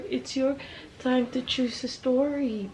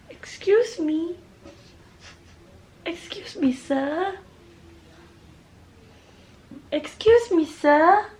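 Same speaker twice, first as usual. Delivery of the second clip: playful high voice; 8 semitones higher, 5 dB louder than usual. Pretend. Child-like playful voice to kid.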